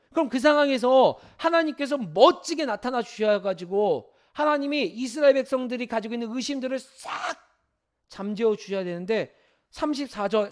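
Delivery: normal talking voice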